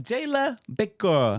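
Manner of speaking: high, slow, loud name call; imitating his daughter. Father imitating daughter shouting her name at baptism. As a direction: draw out the name loud and proud. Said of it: "She was like".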